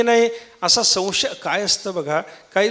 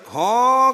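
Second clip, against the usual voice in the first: donkey bray; 4 semitones higher than usual. Retold or imitated. Preacher shows the congregation a donkey's bray. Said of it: "त्या गाढवाचं ओरडणं".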